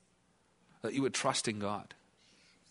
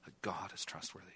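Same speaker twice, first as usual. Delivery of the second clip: hushed; 6 semitones lower, 13 dB quieter than usual. Acted. Hushed climax of the sermon point.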